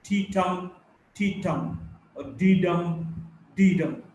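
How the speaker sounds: "T-tum, D-dum" as weak-strong beats; language-lab drill, "D-dum" leaning on "dum"; iambic rhythm. Shown as a demonstration. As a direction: tap out "T-tum" with the weight on "tum". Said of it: "they would pronounce as".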